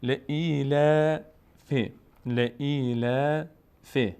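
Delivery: ل ای ل فی very slow, long vowels and thin laam; thin, drawn-out recitation. Shown as a demonstration. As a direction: stretch ای and the light final ل before فی.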